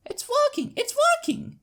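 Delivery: high, loud, thrilled filly shout; excited filly yell. Acted. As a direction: yell it out with pure delight. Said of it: "she yells".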